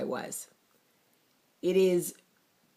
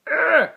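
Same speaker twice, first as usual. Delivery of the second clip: sudden loud high ugh of exasperation; frustrated ugh; 13 semitones higher, 12 dB louder than usual. Retold or imitated. Venting to viewers about anger with husband, loud exasperated ugh.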